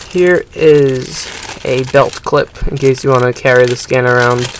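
ordinary talk, nothing marked

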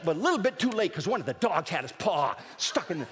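ordinary talk

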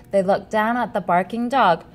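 ordinary delivery, nothing marked

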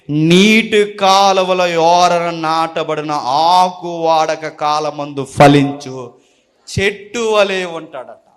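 slow, low, measured verse reading; scripture recitation. Acted. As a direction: recite the verse slowly, drawing out the words. (లేఖనాన్ని నెమ్మదిగా, తగ్గు స్వరంతో పఠించడం)

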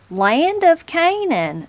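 high, drawn-out cry of amazement; astonished exclamation. Acted. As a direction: exclaim it slowly, pitched well up. Granny exclaims at the rough route.

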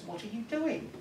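high voiced wife, baffled; a wife cut off mid-kiss; puzzled wife. Wife asking her husband why he pocketed the frog, high and puzzled. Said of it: "And she says".

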